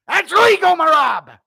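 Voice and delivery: loud, high cornerman holler; Matt Serra impression. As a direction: shout it urgently from the corner. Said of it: "screaming in Matt Serra's voice".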